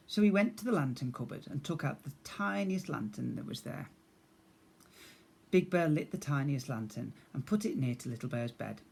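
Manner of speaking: usual speaking voice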